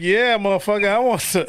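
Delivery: high, emphatic mock yes; eager acted reply. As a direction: blurt an eager yes, playing it up.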